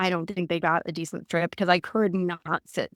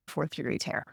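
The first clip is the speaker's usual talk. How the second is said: whispered, much softer reveal; hushed; 5 semitones lower, 9 dB quieter than usual. Real, not acted. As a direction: drop to a whisper for the shock. Shocking news about the tear.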